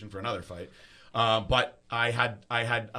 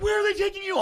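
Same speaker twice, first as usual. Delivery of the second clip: very high, mock-panicked voice; freaking out; 6 dB louder, faster than usual. Imitating tripping friends as he's taken away, freaking out. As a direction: squeal it loudly like a panicking friend. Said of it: "and they're freaking out".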